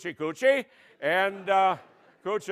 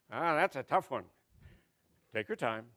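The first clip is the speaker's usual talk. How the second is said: ordinary delivery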